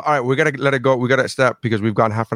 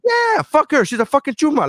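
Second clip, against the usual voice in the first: excited outburst. Retold or imitated. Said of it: "was it chumeria?".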